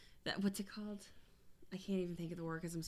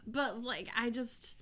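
ordinary delivery

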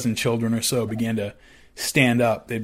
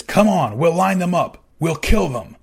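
raised, higher urging voice; louder hard-pressing order; loud urging command; 7 semitones higher, 6 dB louder than usual. Retold or imitated. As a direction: push them to join the killing. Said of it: "and he says".

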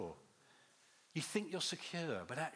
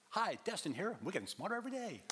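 higher-pitched YouTuber intro imitation; Destin; 6 semitones higher than usual. Speaker imitating a YouTube host greeting viewers, perky intro impression. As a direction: mimic a chirpy channel catchphrase.

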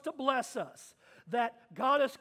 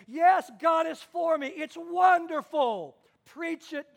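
loud, high cry of joy; acted jubilant shout; exultant believer; 5 semitones higher, 7 dB louder than usual. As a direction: rejoice aloud, urging the preacher on. Acted rejoicing of saved listener.

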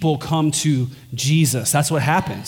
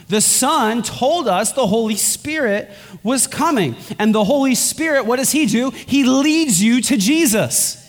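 pitched up, fervent sermon delivery; rising preaching; 6 semitones higher than usual. Acted. Preacher to congregation about the Spirit, building up higher. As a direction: lift it as the point builds.

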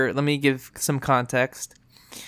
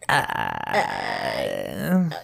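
very low creaky fry groan; deep crackling vocal fry; 4 dB quieter than usual. Shown as a demonstration. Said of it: "all of the extra vocal fry that you can ever ask for".

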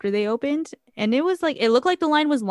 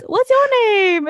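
very high, loud, drawn-out character call; Sad Eye Joe; 14 semitones higher, 9 dB louder than usual. Retold or imitated. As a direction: imitate him calling out, slow and high. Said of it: "And then he goes".

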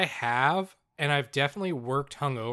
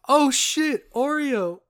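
higher, louder giddy stoner voice, a little hammed-up; stoned cashier; 10 semitones higher, 6 dB louder, slower than usual. Retelling the high cashier's delight, a giddy mock stoner voice. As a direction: mimic a blissed-out guy spotting the Oreo treat. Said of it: "picks it up and goes".

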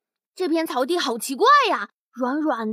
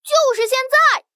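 excited shout; 7 semitones higher than usual. Acted. Monster falls, time to act.

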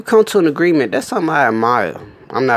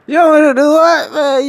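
mock outrage; 5 dB louder than usual.

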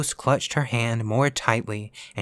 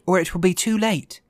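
high-pitched urgent warning; urgent cry; 8 semitones higher than usual. A narrator's reading. Ghost warning Virginia time runs out, urgent cry. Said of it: "cried the ghost".

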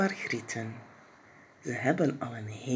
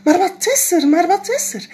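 much higher and louder worried call; alarmed calling; 14 semitones higher, 8 dB louder than usual. Acted. Mama calling out in alarm to Jip. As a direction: call down anxiously from the stairs. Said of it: "ze roept".

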